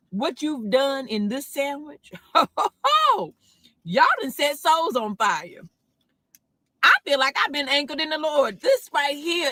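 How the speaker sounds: high, loud, fired-up testimony; playful preaching. Pretend. Thanking the sandwich makers, fired-up mock church testimony. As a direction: preach it with a big whoop in the middle.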